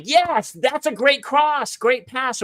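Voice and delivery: high, stretched-out encouragement; positive cheering. Retold or imitated. Parent praising kids at soccer game, high-pitched cheer. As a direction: imitate a supportive parent cheering from the sideline. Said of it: "I'm usually really positive".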